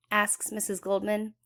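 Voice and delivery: plain reading voice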